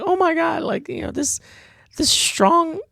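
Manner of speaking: high, breathless fangirl gushing with giggles; swooning girl voice. Retold or imitated. Girl gushing over the commander fighting for her, high swooning voice. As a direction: gush like a smitten girl watching her hero. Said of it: "That's what I hear when she makes that pose".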